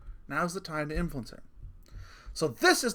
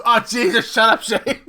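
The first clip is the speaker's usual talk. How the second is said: laughing protest; 7 semitones higher, 13 dB louder than usual. Retold or imitated. Buddy disgusted and laughing.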